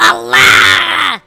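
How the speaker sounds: long open yell; falling shout. Real, not acted. Player shouting as he drops into lava, falling shout. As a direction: cry out as you plunge.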